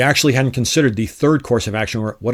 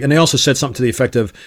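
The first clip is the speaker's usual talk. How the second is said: ordinary delivery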